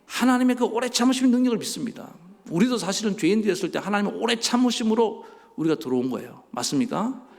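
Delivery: high, loud proclamation of faith; impassioned preaching. Real, not acted. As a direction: preach it with full conviction. Preaches at full force.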